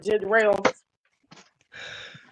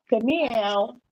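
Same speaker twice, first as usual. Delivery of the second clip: ordinary talk, nothing marked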